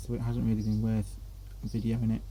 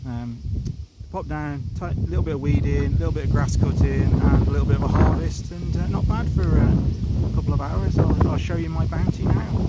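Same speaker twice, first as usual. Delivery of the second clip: loud, raised voice outdoors; shouting over wind; 2 semitones higher, 9 dB louder than usual. Real, not acted. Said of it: "I'm hoping you can hear me over the wind".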